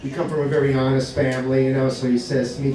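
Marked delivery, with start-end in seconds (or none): none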